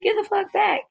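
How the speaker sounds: high, imitated telling-off; gym teacher's scolding. Gym teacher ordering girls off Carrie, high mock scolding. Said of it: "the gym teacher".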